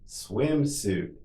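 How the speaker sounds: drawn-out word for learners; slow model. Shown as a demonstration. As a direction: say it slowly and evenly for repetition. Teacher models word for repetition.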